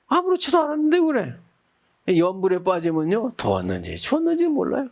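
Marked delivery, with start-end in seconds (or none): 0.1-1.3 high light reply. Retold or imitated. Chanter answers the monk.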